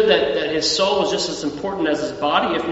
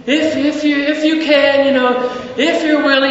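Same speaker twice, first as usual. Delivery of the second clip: higher, halting prayer voice; mock hesitant pleading; 7 semitones higher than usual. People unsure God will help them, mock hesitant pleading. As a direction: act out a timid, faltering request.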